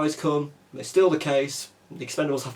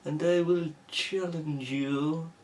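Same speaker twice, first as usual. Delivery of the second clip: slow, hammed-up Russian villain accent; cartoonish Russian accent with a menacing edge; 3 semitones higher, slower than usual. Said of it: "moves on to typical, stereotypical Russian".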